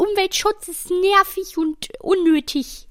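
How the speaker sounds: mocking quote voice. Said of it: "Die sagen da".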